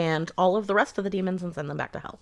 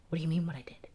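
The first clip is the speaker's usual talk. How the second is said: whispered, much softer, retold evasion; mock whisper; 2 semitones lower, 10 dB quieter, faster than usual. Confronted uncle answering in a retold whisper. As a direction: hush it as a cornered man. Abuser confronted, feigning innocence.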